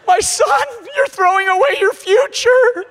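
high, loud wail of protest; hammed-up distraught shriek, pitched far up; horrified mother. Mother pleading with son walking forward to missions, mock-hysterical wail. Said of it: "his mother looks up".

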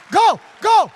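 slow, loud, high shout. Retold or imitated. Sergeant shouting the preacher on his way. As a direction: send him off at full force. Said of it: "Go save the child, preacher".

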